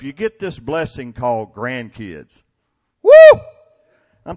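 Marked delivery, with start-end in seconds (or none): 3.0-3.4 very high, loud whoop of delight; joyful whoop. Real, not acted. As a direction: let out a big happy woo. Cheering about grandkids.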